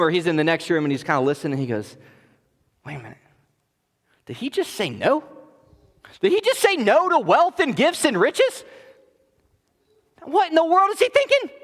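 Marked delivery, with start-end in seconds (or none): 2.8-11.5 incredulous. Retold or imitated. Gehazi overhears the refusal, incredulous.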